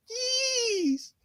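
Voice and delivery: high squeal stretching the yes; squeaky drawn-out yes. Retold or imitated. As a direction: squeak out a long high Yees. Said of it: "And then he's put".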